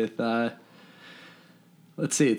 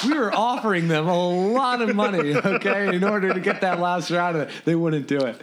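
laughing protest; 6 semitones higher than usual. Real, not acted.

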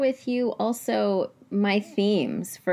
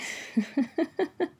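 a high, breathy half-laugh holding back tears; teary laugh; 9 semitones higher, 4 dB quieter than usual. Real, not acted. Host moved by her mom's praise, laughing through tears. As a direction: laugh to keep from crying. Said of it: "I'm gonna try not to cry".

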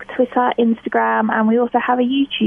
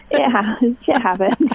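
words breaking into real laughter; laughing; 3 semitones higher than usual. Real, not acted. Guest laughing at the shift happens pun with the host. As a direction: giggle through the phrase.

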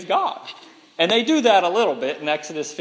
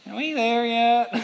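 put-on higher, drawn-out whining child; whiny kid; 5 semitones higher than usual. Child on a long road trip, whining. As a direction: ask like a bored kid in the back seat. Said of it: "your child on the seventh hour".

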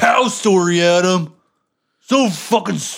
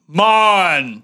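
louder barked syllable; shouted syllable; 5 dB louder than usual. Pretend. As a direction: recreate the shout. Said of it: "You have to recreate my part".

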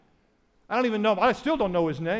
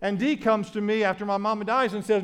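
ordinary talk

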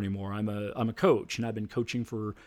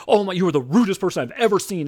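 indignant woman; 7 semitones higher, 7 dB louder, faster than usual. Retold or imitated. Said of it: "And then she goes".

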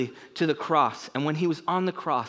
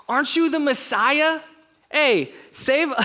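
high, loud taunting shout; loud sneering taunt; jeering yell; 10 semitones higher, 4 dB louder, slower than usual. Acted. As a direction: yell it up at him as a mocking criminal. Criminal yelling insults.

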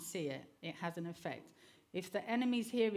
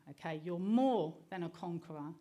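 normal talking voice

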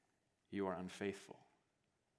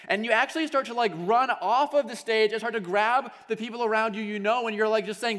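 loud, high, driving sermon voice; fired-up preacher telling the story; full-force preaching; 18 dB louder than usual. Acted. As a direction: preach it out to the whole room.

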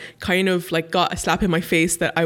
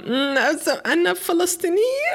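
high, sing-song proud reply; proud answer; 10 semitones higher than usual. Retold or imitated. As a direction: say it with a proud lilt, much higher. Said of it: "فلسطينية، أخ".

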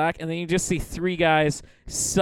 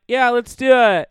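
higher, louder mock enthusiasm; eager retold agreement; 8 semitones higher, 8 dB louder than usual. Friends agreeing to visit Santa, mock excited. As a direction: jump in like an eager pal.

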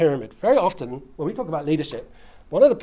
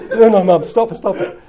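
loud, laughing mock protest; hammed-up squirming teenager begging mum to stop; mortified teenage son; 4 semitones higher, 11 dB louder than usual. Sons begging their mum to stop, hammed-up and laughing.

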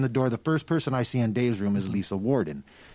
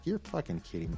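low and much softer, stunned; awed disbelief; 6 semitones lower, 10 dB quieter, faster than usual. Retold or imitated. Told the band is really there, hushed awe. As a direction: say it under your breath in amazement. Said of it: "And I'm like".

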